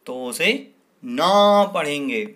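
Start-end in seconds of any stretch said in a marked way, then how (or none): 1.0-2.2 ना said slowly and loudly, pace eased; stress on ना. Shown as a demonstration. Teacher explaining the आ sign, stressing ना. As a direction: lean on ना so pupils hear the आ sign. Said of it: "आ की मात्रा लगी है".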